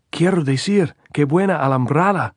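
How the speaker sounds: high, loud Spanish shout. A narrator's reading. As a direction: yell the praise up at them. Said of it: "Andres shouted".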